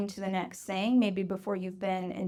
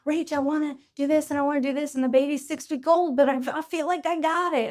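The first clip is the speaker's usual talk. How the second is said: higher and faster, rushing through plans; an overachiever who can't sit still; eager new parent; 6 semitones higher, 3 dB louder than usual. Retold or imitated. New parents reaching out to Rach, eager and hurried. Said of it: "high achiever new parents ... they're like".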